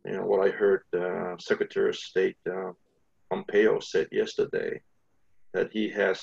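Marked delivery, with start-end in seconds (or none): none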